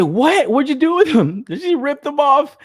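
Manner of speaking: high, loud, incredulous yelling; retold outraged outburst. Teen demanding his torn-down posters from mom, outraged outburst.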